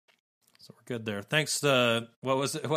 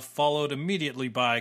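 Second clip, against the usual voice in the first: ordinary delivery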